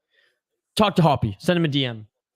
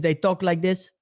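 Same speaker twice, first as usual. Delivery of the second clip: imitating a Montreal accent; joking impression of Montreal speech. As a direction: put on a mock Montreal accent. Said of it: "If you go to Montreal".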